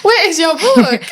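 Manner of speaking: incredulous repeat. Real, not acted. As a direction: repeat it slowly, amazed and laughing. Amazed listener repeats question, laughing.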